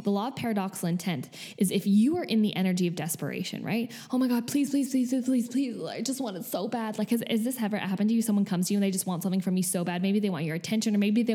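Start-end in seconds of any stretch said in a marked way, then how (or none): 4.1-7.0 a touch higher, pleading please after please; lightly acted whiny begging; mock desperate begging. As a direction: beg like you want it too badly.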